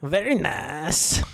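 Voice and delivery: put-on a slow, drawn-out Borat accent; half-hearted Borat voice; Borat impression. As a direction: say it like Borat, lightly. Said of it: "You can do a Borat".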